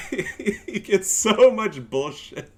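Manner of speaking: slow words through laughter; laughing. Real, not acted. Laughing at the patch, slow amused disbelief.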